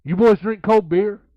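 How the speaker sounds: put-on loud, high booming question from the old wrestler; Robert Gibson quote. Veteran wrestler asking the young kids, loud and high. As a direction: boom it out like a veteran wrestler offering beer. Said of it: "First words out of his mouth is".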